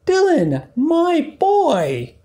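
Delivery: very high, tender mother call; loving mother duck. Acted. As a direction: greet your lost son warmly.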